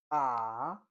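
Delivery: Mandarin third tone on a long AH, falling then climbing. Shown as a demonstration. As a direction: drop AH down and swing it back up. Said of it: "You fall and rise".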